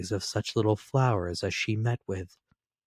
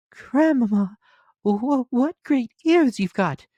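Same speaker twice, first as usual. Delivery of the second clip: put-on much higher, louder child voice; little girl voice; 7 dB louder than usual. A narrator's reading. Little girl answering the wolf, high and childlike. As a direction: pipe it up like a small girl.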